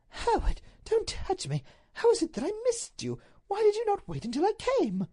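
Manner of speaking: high-pitched wifely distress; distressed Duchess. Acted. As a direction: recoil and reproach your husband. Duchess voiced, shrinking from husband.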